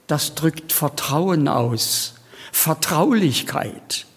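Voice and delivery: normal talking voice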